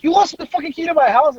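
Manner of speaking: high, outraged retold question; incredulous. Buddy's brother on hearing the house key is lost, incredulous. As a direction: mimic an exasperated brother in disbelief.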